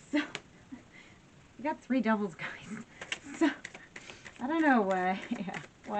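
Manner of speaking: laughing through the words. Real, not acted. Laughing over three devil cards.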